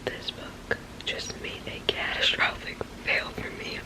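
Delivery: furious but whispered under breath; seething, hushed swearing; angry whisper. Real, not acted. Reader fuming at the book, still hiding: whispered fury.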